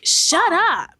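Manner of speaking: high, swooping mock-dramatic "shut up"; mock outburst. Vlogger mimicking a cringey film star telling a woman off, mock-dramatic.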